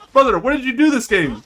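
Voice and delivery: rapid-fire disbelieving scold; exasperated at a teammate who did nothing. Real, not acted. Scolding the player.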